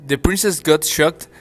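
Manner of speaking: usual speaking voice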